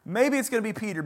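ordinary talk

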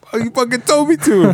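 put-on high, indignant kid voice; whiny protest. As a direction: protest like a wronged kid, half laughing. Kid protesting unfair blame.

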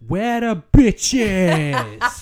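Thor. Pretend. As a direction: drawl it out like a brash god. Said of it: "it is almost definitely".

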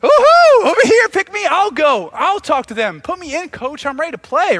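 whooping high and loud, settling slightly; jacked-up excitement. Acted. Isaiah changed by God's presence volunteers, whooping excitement. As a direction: burst out like a player begging to go on. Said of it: "jacked up and excited".

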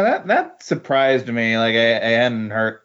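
ordinary talk

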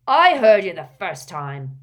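loud, irritable snap; snapping. A narrator's reading. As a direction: cut him off loudly. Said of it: "snapped Gaunt".

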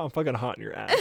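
laughing through the words. Real, not acted. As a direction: joke while laughing. Joking about haunting.

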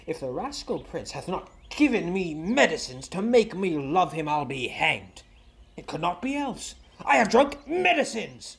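ordinary talk, nothing marked

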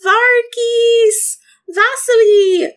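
high, drawn-out calling scream; screaming for companions. Acted. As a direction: shout the names out into the distance. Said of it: "Mercy is just going to scream".

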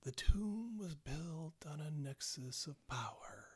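slow, hushed whisper from the grave; eerie undead whisper; ghastly whisper. Acted. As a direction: whisper it like a long-dead corpse, with long pauses. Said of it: "this kind of ghastly whisper from the grave".

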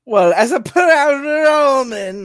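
slow, high, loud hammed-up Roman accent; mock Roman accent. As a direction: puff up as a proud Roman in a put-on Roman accent. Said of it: "do it in a good, uh, Roman accent".

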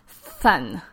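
"thun" with the tongue pushed against the front teeth, a th for the s; dentalized lisp demo. Shown as a demonstration. Therapist demonstrating a dentalized lisp to parents, "thun" with th. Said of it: "instead of sun, it would become".

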